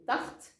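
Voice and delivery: TARTE with a short, quick A; son court. Shown as a demonstration. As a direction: cut the vowel short in TARTE. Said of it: "mon son devient court". (« tarte » avec un A bref)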